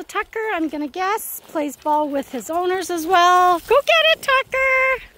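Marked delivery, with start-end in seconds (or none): 3.6-5.0 very high, loud throw cheer; squeaky dog voice. Real, not acted. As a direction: send the dog after the ball.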